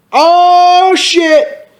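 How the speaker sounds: drawn-out high exclamation. A narrator's reading. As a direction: cry it out high. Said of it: "Dumbledore yelled at them".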